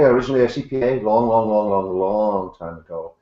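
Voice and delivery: ordinary talk, nothing marked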